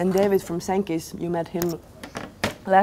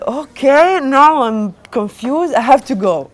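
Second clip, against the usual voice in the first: flustered; 5 semitones higher, 7 dB louder than usual. Retold or imitated. Flustered after owner reveals himself.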